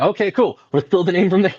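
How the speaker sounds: high, loud eager burst; excited. Retold or imitated. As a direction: sell the eureka moment. Excited retelling of own idea.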